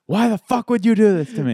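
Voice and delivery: high, loud angry outburst; imitated yell of betrayal; mad. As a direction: act out a furious friend demanding an answer. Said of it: "And he's mad".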